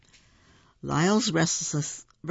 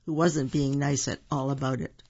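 ordinary delivery

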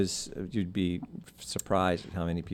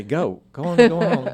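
louder, higher mock command; shooing away; 8 semitones higher, 10 dB louder than usual. Man shooing what follows him, raised mock-commanding voice. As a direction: shoo it off like a stray following you. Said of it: "You say".

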